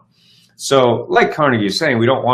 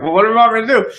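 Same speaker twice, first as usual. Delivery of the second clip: high-pitched, hammed-up helpless question; mock exasperation; 12 semitones higher, much faster than usual. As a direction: throw up a squeaky protest to your partner. Acting out his exasperated protest.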